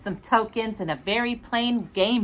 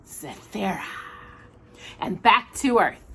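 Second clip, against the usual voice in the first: ordinary delivery, nothing marked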